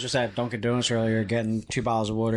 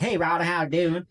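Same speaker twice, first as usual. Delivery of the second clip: put-on higher-pitched Panhandle accent greeting; Rodney Mason; 4 semitones higher than usual. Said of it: "that's how he talks".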